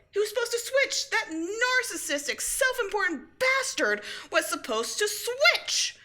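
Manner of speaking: unhinged shout at the rival; hysterical rage. Acted. Villain ranting, laugh-cry.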